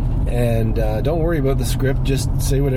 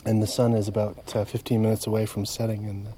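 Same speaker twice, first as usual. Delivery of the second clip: calm and soft; 3 semitones lower, 7 dB quieter than usual. Real, not acted. Calm sunset update, softer.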